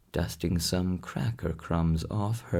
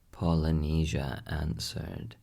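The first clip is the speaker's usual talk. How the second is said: usual speaking voice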